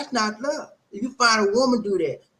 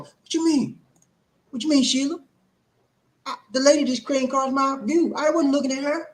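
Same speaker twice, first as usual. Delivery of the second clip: higher, whiny protest of innocence; mock defensive boyfriend; 8 semitones higher than usual. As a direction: play the accused boyfriend pleading innocent. Boyfriend defending himself to Sheila.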